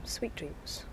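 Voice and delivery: soft, slow near-whisper; hushed tender wish. Retold or imitated. Doctor recalls carers wishing residents goodnight, hushed and tender. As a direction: let it sink almost to a breath.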